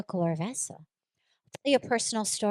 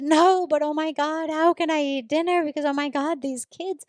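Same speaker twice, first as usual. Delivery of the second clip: high, louder anguished retelling of her younger self; distraught, near tears; 7 semitones higher, 4 dB louder than usual. Retold or imitated. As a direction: cry it out as if it's unbearable. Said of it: "and I'm sobbing".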